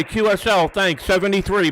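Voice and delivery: ordinary talk, nothing marked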